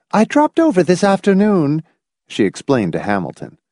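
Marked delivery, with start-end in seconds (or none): 0.1-1.8 high-pitched, effusive matron; joyous gushing. A narrator's reading. Visiting matron explaining to Hamilton, joyous gushing. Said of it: "informed him joyously".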